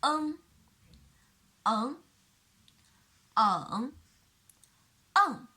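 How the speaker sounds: "en" in each tone; four tones demo. Shown as a demonstration. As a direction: run "en" through the tones. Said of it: "a with the velar nasal".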